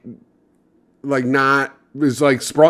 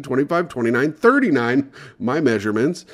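ordinary delivery

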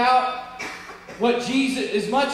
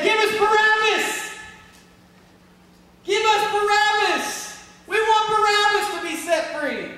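crowd's shout; 11 semitones higher, 3 dB louder than usual. Acted. Preacher acts the crowd answering who to release, shouting. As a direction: shout it out like a crowd demanding a prisoner. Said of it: "And they said".

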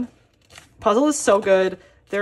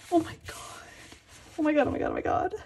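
awed whisper; 4 semitones higher, 7 dB quieter, much faster than usual. Real, not acted. Overwhelmed by the signed album.

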